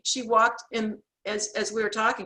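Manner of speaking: usual speaking voice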